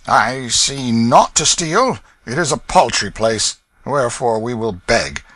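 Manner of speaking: a rough vagabond, unimpressed; Hugo the thief. Acted. Hugo proposes begging.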